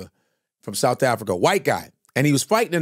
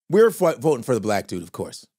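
ordinary talk

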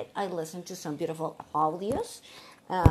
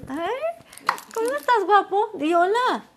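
doting parent talk; 13 semitones higher, 7 dB louder than usual. Real, not acted. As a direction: coax your little one to say hello. Greets small son who walks in.

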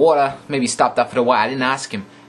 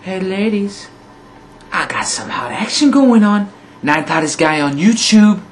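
higher, louder hammed-up pickup line voice; mock ladies' man; 8 semitones higher, 5 dB louder than usual. Vlogger hamming it up for female viewers, mock ladies' man voice. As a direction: play a cheesy smooth-talker hitting on girls. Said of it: "So I'll say".

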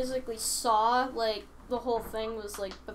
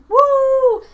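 loud, high whoop of delight; excited cheer; 14 semitones higher, 16 dB louder than usual. Retold or imitated. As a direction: cheer it out like a crowd. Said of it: "some were like".

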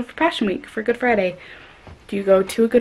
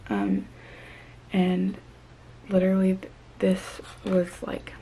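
hushed voice; 3 semitones lower, 11 dB quieter than usual. Real, not acted. Late-night hushed talk.

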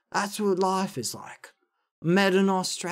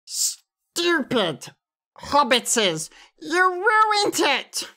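high, strained creature voice; spiteful wail, drawn out at the end; Gollum; 11 semitones higher, much slower than usual. Shown as a demonstration. Creature blaming the hobbits for ruining it, Gollum impression. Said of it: "Gollum. Gollum".

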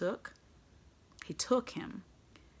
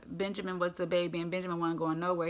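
ordinary delivery

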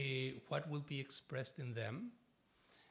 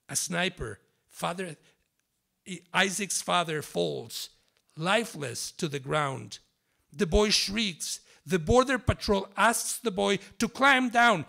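dramatic literary reading with long pauses; 2 semitones higher, 9 dB louder than usual. Acted. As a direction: perform it like a spoken-word piece for an audience. Author reads literary text aloud.

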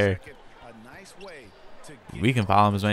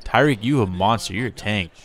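ordinary talk

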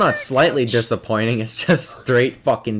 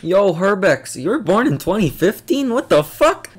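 high, swooping disbelief; incredulous outburst; 6 semitones higher than usual. Real, not acted. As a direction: exclaim in rising, high-pitched astonishment at a player's birth year. Astonished at a player's apparent birth year.

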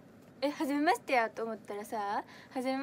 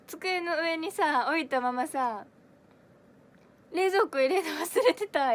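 loud, raised voice of sudden dismay; real oh-no moment, much louder; dismayed realisation; 4 semitones higher, 8 dB louder, slower than usual. Real, not acted. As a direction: blurt it out as it dawns on you. Suddenly realises sushi was left out.

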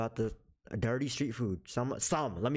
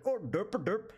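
goofy higher derp voice; mock dumb prankster; 8 semitones higher, slower than usual. Pretending to act dumb in Indian stores, higher and goofy. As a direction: play a clueless prank creator. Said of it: "be like".

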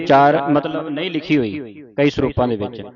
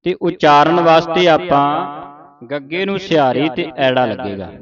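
usual speaking voice

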